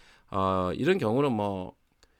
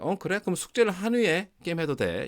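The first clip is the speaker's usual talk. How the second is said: higher, indulgent parent granting permission; lightly voiced parent; 9 semitones higher than usual. Parent answering the son's request, indulgent and higher. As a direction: give permission like an easygoing parent.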